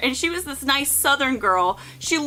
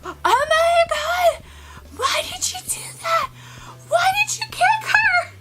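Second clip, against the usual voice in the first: far higher, shrieking and crying, with a breathless whisper in the middle; exaggerated squealing outrage; mock hysterical drunk girls. Drunk girls react to kick.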